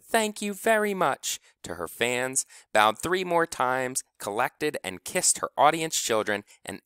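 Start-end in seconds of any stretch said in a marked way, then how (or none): 0.0-1.4 shouting. A narrator's reading.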